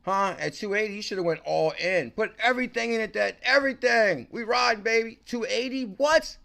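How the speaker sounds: all-in celebration; hyped up. Real, not acted. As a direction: push everything in, rising hype. Hyped over missed gains.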